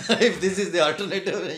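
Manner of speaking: high, loud, through real laughter; laughing jibe. Real, not acted. Guest joking about the so-called alternative, laughing. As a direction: break up laughing while saying it.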